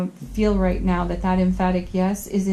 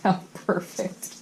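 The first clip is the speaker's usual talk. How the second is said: amused; 6 dB quieter, slower than usual. Real, not acted. Amused at a fitting card.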